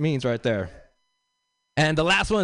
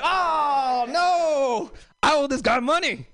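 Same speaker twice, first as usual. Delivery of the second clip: mock panic; 9 semitones higher, much slower than usual. Comic hearing the driver will pick up Jesus, mock panic. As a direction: yelp it like you just saw who you owe money.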